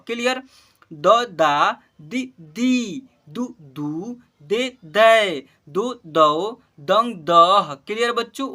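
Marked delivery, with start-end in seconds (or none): 0.9-7.7 slow, loud, drawn-out syllable recitation; syllable drill. Shown as a demonstration. Teacher leads class in reciting barahkhadi, slow and loud. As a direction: call out each syllable clearly for the class to repeat. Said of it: "उच्चारण कर रहा हूँ".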